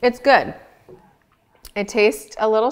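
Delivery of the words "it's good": mouth full. Real, not acted. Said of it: "talk with my mouth full".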